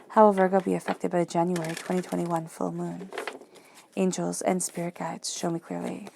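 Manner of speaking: quiet, even ritual repetition; soft invocation. Acted. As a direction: intone it like a prayer before the cards. Repeats question and invocation again.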